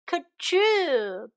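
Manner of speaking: high, drawn-out storybook sneeze; mock sneeze. Betty tickled by chimney smoke, mock sneeze. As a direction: act out a sneeze as a spoken word. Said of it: "Betty sneezed".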